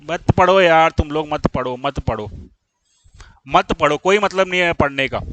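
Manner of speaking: weary, low-pitched resignation, sinking away; fed-up scolding. Real, not acted. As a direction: give up on the class, voice dropping. Teacher fed up with students.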